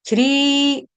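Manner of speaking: श्री held on a long ee; shreee, one sustained श्री; long vowel. Shown as a demonstration. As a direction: stretch the ee of श्री like a chanted note. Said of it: "श्री मात्रा जो है वो दीर्घ है".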